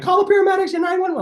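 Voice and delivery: high, fast, urgent mock panic; panicked wife. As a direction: imitate the wife shouting for help. Wife panicking after the crash.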